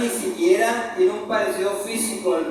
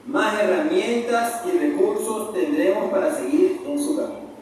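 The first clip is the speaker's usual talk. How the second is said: usual speaking voice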